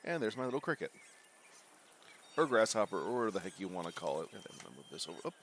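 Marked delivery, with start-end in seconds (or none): none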